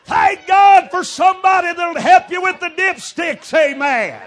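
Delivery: loud, high preacher's cry; fiery preaching. Acted. Preacher rousing his congregation, loud fiery preaching. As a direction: preach it out to the pews.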